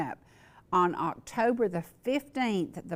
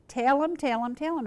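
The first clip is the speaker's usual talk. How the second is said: imitating him, higher and louder on the first "tell 'em"; riled-up urging; 7 semitones higher, 5 dB louder than usual. Friend pushing the host to warn her viewers, riled up. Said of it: "he just got all riled up".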